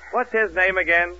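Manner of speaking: normal talking voice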